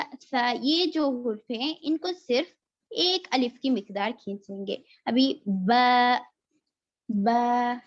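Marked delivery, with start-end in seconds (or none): 5.1-7.8 बा stretched slowly to two counts; Arabic बा recited with the long a drawn out. Shown as a demonstration. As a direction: hold the vowel of बा long. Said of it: "इस तरह से खींचेंगे".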